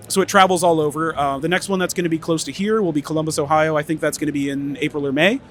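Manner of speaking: ordinary delivery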